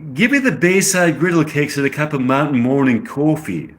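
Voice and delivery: put-on amateur British accent, ordering breakfast. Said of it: "I get the British accent".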